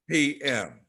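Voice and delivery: ordinary talk, nothing marked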